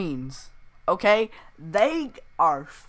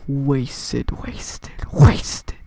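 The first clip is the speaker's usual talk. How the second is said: echo effect; 5 semitones lower, 6 dB louder, much slower than usual. Acted.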